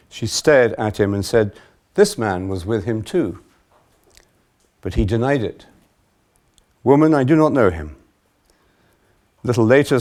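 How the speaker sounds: loud, projected Gospel narration; dramatic public Bible reading; scripture reading. A narrator's reading. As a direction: read it aloud with firm, emphatic quotes. Reads the Gospel passage aloud.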